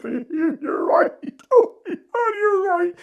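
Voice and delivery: high, squeaky mock whimper with a laugh; hammed-up caught-out pleading, half laughing; flustered admission. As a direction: act out a high, flustered confession. Acts out own flustered, caught-out reply.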